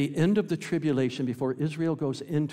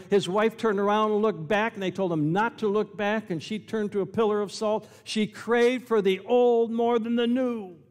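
high, loud, driving pulpit storytelling; full-force preaching; 8 semitones higher, 6 dB louder than usual. Real, not acted. Preacher tells congregation of Lot's escape, full-force preaching. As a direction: preach it raised and insistent.

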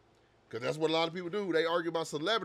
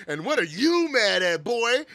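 mock taunt; 4 semitones higher, 6 dB louder than usual. Mocking the teacher on screen.

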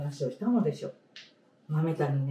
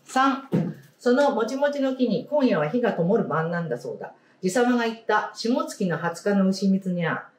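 story reading; 4 semitones higher than usual. Acted. Teacher reading a picture book to the class, storybook voice. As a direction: clear storybook read-aloud voice.

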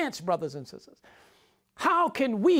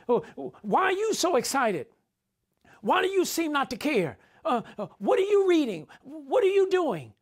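higher and rising, bewildered questioning; mock confusion, pitched up; puzzled foolish believer; 5 semitones higher than usual. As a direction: act baffled at the excited ones.

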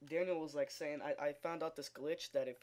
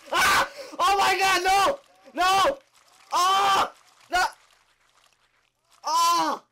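high, loud terrified yells; panicked screaming; 22 dB louder, slower than usual. Real, not acted. Vlogger attacked by thirty spiders, real panicked screaming. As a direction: scream as the spiders swarm you. Spiders swarm him in game.

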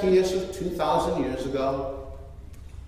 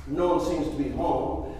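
usual speaking voice

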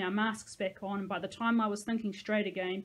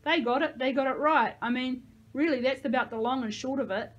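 raised, overeager agreement; tinge of desperation; 4 semitones higher, 6 dB louder than usual. A narrator's reading. Jake agrees with the kids' family roles, slightly desperate. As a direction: insist on it a bit too loudly. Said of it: "a tinge of desperation thrown in there".

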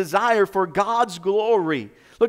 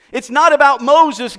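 louder, higher impassioned plea to God; pleading prayer; 7 semitones higher, 10 dB louder than usual. Retold or imitated. Moses pleading with God for his name's sake, loud earnest plea. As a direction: beg God earnestly, voice lifted.

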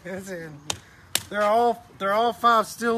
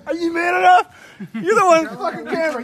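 mocking shout; 7 semitones higher, 5 dB louder than usual. Real, not acted. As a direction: fling the taunt back loudly. Throws taunt back, laughing.

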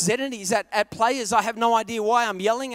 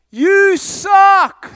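heckler yell; 7 semitones higher, 13 dB louder than usual. Retold or imitated. Heckler yells at athlete.

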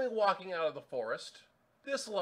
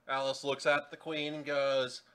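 ordinary talk

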